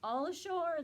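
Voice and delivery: loud, high call; shouted. A narrator's reading. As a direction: call it out to the whole ship. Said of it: "the men shouted".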